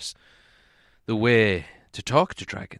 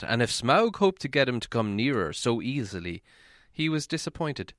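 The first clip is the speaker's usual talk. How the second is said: ordinary delivery, nothing marked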